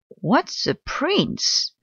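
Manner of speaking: high, slow, puzzled question; puzzled Cousin Dippy. Acted. Cousin Dippy hears about the prince, slow baffled question. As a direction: ask it slowly, baffled. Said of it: "asked Cousin Dippy".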